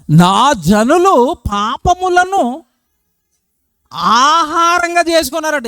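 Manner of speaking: very slow, intoned verse; solemn scripture reading. Acted. The preacher reads verse eight to the church, very slow and solemn. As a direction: read the verse like a grave pronouncement.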